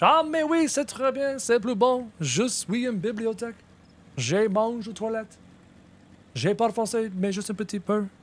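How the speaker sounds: high, hammed-up French phrases; terrible French accent. Streamer showing off bad French to chat. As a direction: ham up schoolbook French, pitched high. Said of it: "Sounds like terrible French".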